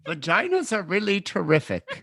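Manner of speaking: high-pitched mock slogan. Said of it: "that's the whitest thing I've ever said".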